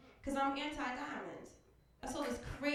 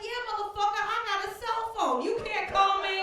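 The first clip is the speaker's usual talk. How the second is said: loud, higher, fed-up shout; mock angry yell; 8 semitones higher, 7 dB louder than usual. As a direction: storm off yelling. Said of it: "I was like".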